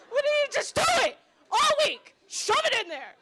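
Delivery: high-pitched, loud hammed-up yelling; shouted quarrel. Vagina roommate insisting all week, loud high yelling. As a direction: shriek it like a demanding roommate.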